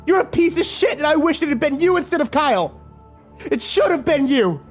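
high, cutting accusation; venomous female voice; hateful outburst. A narrator's reading. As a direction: wound him. Kimber's cruellest words.

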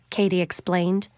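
ordinary delivery

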